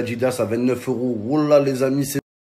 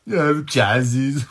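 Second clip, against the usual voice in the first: mock laugh; 11 semitones higher, 6 dB louder than usual. Influencer laughs off bad news.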